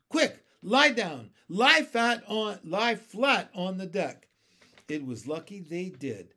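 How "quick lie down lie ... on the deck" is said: loud, high-pitched shouted orders; urgent cry. A narrator's reading. James ordering friends to take cover, urgent shouting. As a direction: shout to get everyone down at once. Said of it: "cried James".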